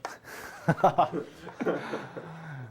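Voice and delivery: drawn-out real laugh, tailing off; amused laugh. Real, not acted. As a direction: laugh at an awkward question. Teacher amused by student's question.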